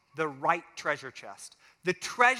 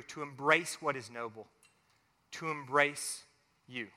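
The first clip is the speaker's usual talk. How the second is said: lower, softened praying voice; closing prayer; 3 semitones lower than usual. Real, not acted. Preacher leads congregation in closing prayer, hushed and reverent.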